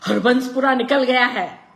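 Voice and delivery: loud, high excited cry; excited shout. A narrator's reading. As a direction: shout out the news, thrilled. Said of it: "ऊंची आवाज में बोला".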